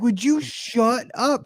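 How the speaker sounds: high, slow, drawn-out mock outrage; exasperated plea. Dad to overconfident daughter, exasperated plea. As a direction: beg her to quit jinxing it.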